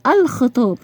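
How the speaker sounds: الخطاب, clear L before the kh; pronounced lam. Shown as a demonstration. Teacher showing pupils a sounded lam, L clearly pronounced in الخطاب. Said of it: "نطقت اللام".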